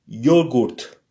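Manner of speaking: "Yogurt" said with a "yo" start and rolled ending; Russian yogurt. Shown as a demonstration. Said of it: "alors on dit yogurt".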